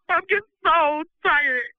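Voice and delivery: tearful and slow, voice breaking; fighting back real tears; worn-out weeping; crying. Real, not acted. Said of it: "forgive me for crying".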